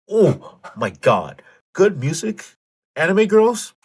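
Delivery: high, loud fangirl squeal; gushing excitement. Real, not acted. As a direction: swoon over it, rising to a squeal. Gushing over favorite rhythm games.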